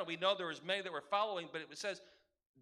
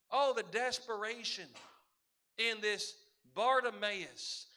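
ordinary talk

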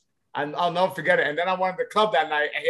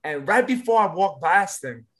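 ordinary talk

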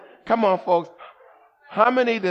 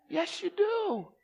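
higher, slow teasing reply; playful God voice; 10 semitones higher, 10 dB quieter, slower than usual. Acted. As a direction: answer like God calling the bluff.

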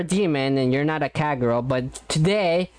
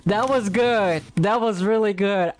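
high, bright excited praise; 7 semitones higher than usual. Real, not acted. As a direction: gush over it.

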